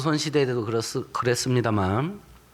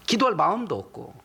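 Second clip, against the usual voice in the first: usual speaking voice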